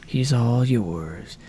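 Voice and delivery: low, slow robotic villain growl; Iron Man suit voice. Retold or imitated. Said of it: "he's like".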